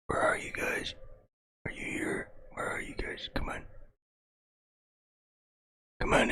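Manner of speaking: breathy whisper right into the mic; mock ASMR whisper. Streamer whispering into the mic to his viewers, mock ASMR. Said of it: "If I was an A S M R channel".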